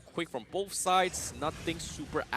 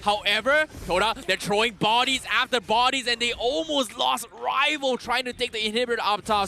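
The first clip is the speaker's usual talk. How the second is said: very high, loud shouted call; esports caster; 8 semitones higher, 4 dB louder than usual. Acted. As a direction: peak hype, voice soaring. Hype esports play-by-play.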